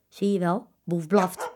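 ordinary delivery

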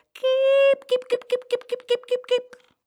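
very high hen call, loud first note then quick repeats; calling chickens. A narrator's reading. Farmer's wife showing how to call chickens, high calling. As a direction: sing out a high call to bring hens running. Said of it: "je moet ze zo roepen".

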